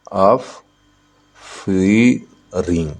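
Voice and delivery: "Offering" very slowly as ऑफ, फी, ring; syllable by syllable. Shown as a demonstration. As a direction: stretch "offering" into three separated beats. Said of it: "तीन syllable". ("Offering" को ऑफ... फ़ी... ring, बहुत धीरे)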